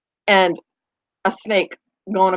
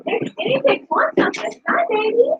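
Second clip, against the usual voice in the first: much higher cooing voice for the guinea pigs; 4 dB quieter than usual. Real, not acted. Owner cooing to her guinea pigs in baby talk. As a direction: coo up high at the little animals.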